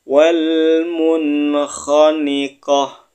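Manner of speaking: slow chanted Arabic; Yu'jibuk recited slowly, final kaf breathed out; Quran recitation. Shown as a demonstration. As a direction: intone it like a tajwid teacher. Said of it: "Sekali lagi".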